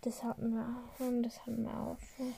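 soft unvoiced whisper. Real, not acted. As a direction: whisper it to the side. Teasing aside while quizzing.

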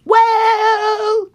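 high, loud, stretched exclamation; indignant Mama Bear. Acted. Mama Bear sniffs her chair, high indignant cry. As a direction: wail it out as an affronted bear. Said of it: "And she goes".

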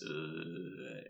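low, hushed drawn-out noise; imitated eerie groan. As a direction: drone a soft, low "uh". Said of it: "she's making this".

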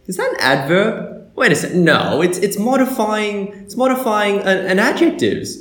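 high-pitched mock puzzlement; confused self-quote. Student replaying his muddle over a syntax diagram, puzzled. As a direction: think aloud, stumped then correcting yourself.

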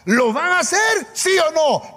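high-pitched, angry ultimatum; annoyed king. Retold or imitated. King threatening the young men who refused, very annoyed. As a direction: demand an answer as an irritated king.

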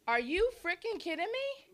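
high, incredulous cry; can't believe the stream crashed; exasperated disbelief. Real, not acted. To the crew when the iPad reboots, exasperated disbelief.

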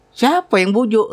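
a hint of an alarmed wife; wife's question.

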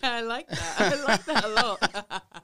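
amused, giggling agreement; laughing along at the joke. Real, not acted.

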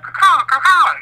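high loud cawing like a crow; bird noise. Retold or imitated. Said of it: "Do the bird noise".

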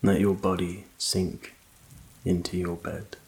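usual speaking voice

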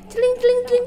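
very high twinkling imitation; imitated glittery chime; comic sparkle effect. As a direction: voice cartoon sparkles in a high pitch. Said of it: "efek-efek komik yang ada sinar-sinar".

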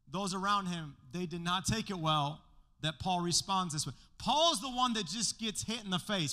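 ordinary delivery

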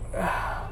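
long, voiceless exhale; worn-out sigh of frustration; frustrated sigh. Real, not acted. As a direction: let out a heavy breath. Can't explain his anger.

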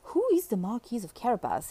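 usual speaking voice

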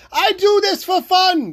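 worked-up yelling. Real, not acted. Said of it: "yelling at you".

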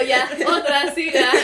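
imitating herself, giggly and loud; mock relaxed. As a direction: laugh through a loud 'whatever, next one'. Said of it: "así toda relajada".

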